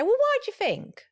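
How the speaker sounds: high, pointed "why"; "Well, why do you think?" with a challenging "why"; sharp tone. Shown as a demonstration. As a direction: snap the question with an edge. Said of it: "Tone is important".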